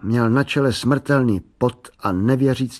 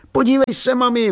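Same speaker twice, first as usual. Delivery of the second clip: put-on high, urgent boyish call; excited calling out; 13 semitones higher, 4 dB louder than usual. A narrator's reading. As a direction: call to mum for attention.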